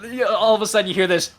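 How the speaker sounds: ordinary delivery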